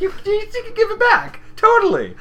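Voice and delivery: very high, loud cartoon squeal; squeaky pony imitation. Imitating the same pony's line.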